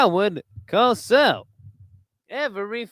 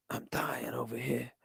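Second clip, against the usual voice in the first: much softer, faint mock dying croak; mock dying Don voice; 7 semitones lower, 15 dB quieter than usual. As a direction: play a feeble dying old man. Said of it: "The Don, he talks like this".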